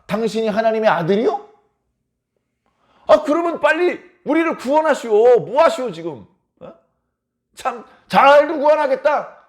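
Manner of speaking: high, sneering taunt in a put-on voice; sneering mockery. A crucified thief sneering at Jesus, mocking and high. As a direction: jeer at him like a brazen criminal. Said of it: "더 되바라진 놈이 있었습니다".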